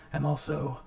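a hint of a theatrical villain; slow, drawn-out villain delivery. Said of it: "[name]'s villain voice".

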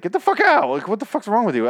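high, loud exasperated outburst; mock angry yell. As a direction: act out kicking an intruder out. Acts out throwing intruding patient out.

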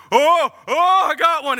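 high, loud whoops; imitating an excited catch; excited fisherman. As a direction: yell it like a fish just hit. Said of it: "he's all excited".

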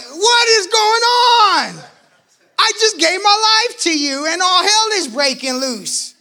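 high, loud, slow wail; anguished outcry. Retold or imitated. Abandoned boyfriend crying out loud and high in anguish. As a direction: cry it out in disbelief. Girlfriend left him.

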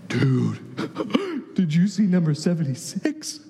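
low, stunned confiding to a teammate; mock-dumbstruck eighth grader; awestruck schoolboy. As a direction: share alarming news with a friend. Boy awed by a huge opponent.

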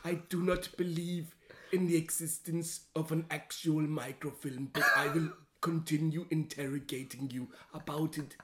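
put-on German-accented, menacing interrogation; Werner Herzog interrogator. Playing Werner Herzog as a Nazi interrogating a friend, in a heavy German accent. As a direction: grill them about the microfilm like Herzog. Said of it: "Werner Herzog as a Nazi interrogating you".

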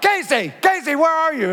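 husband calling for his wife in the water; calling out anxiously. Retold or imitated. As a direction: call out searching the water. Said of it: "I'm like".

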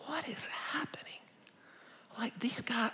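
high, whispered disbelief; amazed whisper. Retold or imitated. Preacher retells his bewilderment at cheering crowds, amazed whisper. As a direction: whisper it in hushed astonishment.

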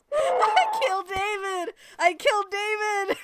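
high, slow laughing shout. Real, not acted. Laughing loud at her co-host's reaction. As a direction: crow it out through laughter. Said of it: "I said that that way just for you".

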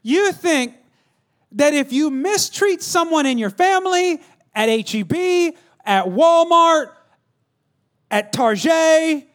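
high, emphatic pulpit delivery; full-force preaching. Real, not acted. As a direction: drive the point home in stretched phrases.

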